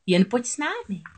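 high moray creature voice; luring moray. A narrator's reading. Moray inviting Ariel to follow, luring.

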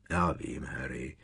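low, soft commiseration; sympathetic grumble. A narrator's reading. Ron comforts angry Harry at breakfast, grumbling sympathetically. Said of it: "zabručel soucitně Ron".

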